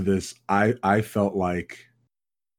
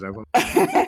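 bedroom voice; 2 semitones higher, 4 dB louder than usual. Pretend. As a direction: purr the apology right into the mic. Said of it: "Damn, that is well done".

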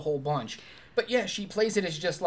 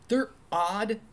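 usual speaking voice

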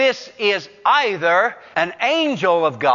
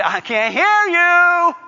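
mock can't-hear-you yell; 10 semitones higher, 5 dB louder than usual. Preacher as God answering Jacob's small voice, mock holler. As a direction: holler it as if demanding a louder answer.

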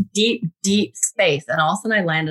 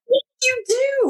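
mock insistent yell. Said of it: "And he's like".

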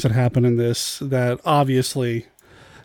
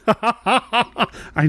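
slow, laughing reply; amused and drawn out, laughter in the voice; real amusement; 7 semitones higher than usual. Real, not acted. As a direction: say it through a chuckle. Amused at an expected question.

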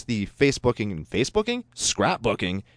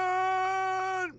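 very high, long-held mock holler, held back in volume; drawn-out mock cry; 7 dB quieter than usual. Co-host echoing a patron's first name, drawn-out mock cry. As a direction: wail the name out as a hammed-up call.

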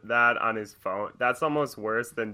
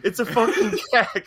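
high, loud imitated outburst; frantic acted shouting, pitched way up; freaking out; 12 semitones higher, 9 dB louder than usual. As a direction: yell it like the exasperated passenger, laugh creeping in. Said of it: "he's, like, freaking out".

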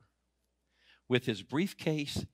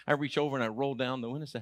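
normal talking voice

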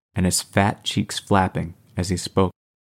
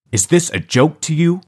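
stern; 9 semitones higher, 4 dB louder than usual. A narrator's reading. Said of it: "implored Sheriff Walker sternly".

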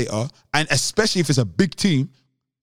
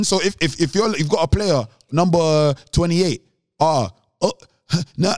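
normal talking voice

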